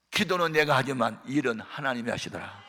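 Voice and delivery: ordinary talk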